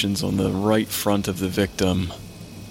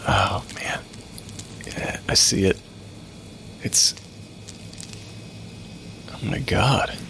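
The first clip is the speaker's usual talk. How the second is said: whispered; 5 dB quieter, slower than usual. Acted. Finding shrapnel in wound.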